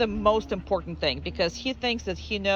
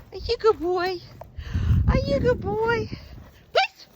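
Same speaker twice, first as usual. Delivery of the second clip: dog praise voice; 3 dB louder than usual. Real, not acted. Trainer rewarding the dog for coming back, squeaky high praise. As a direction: gush over the dog in a sky-high voice.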